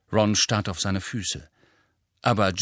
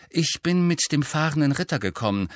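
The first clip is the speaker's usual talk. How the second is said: lively, higher-pitched announcement; buoyant; 7 semitones higher, 4 dB louder than usual. A narrator's reading. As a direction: share the news with a bounce. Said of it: "sagte Hermine beschwingt".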